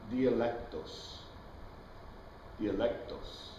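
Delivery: dialectos drawn out slowly and softly, tee-ah-LEK-tohs; slowly and quietly spell out the Greek dialectos; Greek word slowly. Shown as a demonstration. Teacher quoting the Greek text, slow careful dialectos. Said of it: "in his own".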